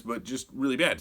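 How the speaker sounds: ordinary talk, nothing marked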